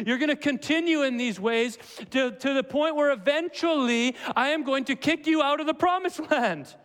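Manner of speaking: rising in pitch as God's verdict builds; building divine rebuke. Acted. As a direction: build to an exasperated pronouncement. Preacher voices God pronouncing exile.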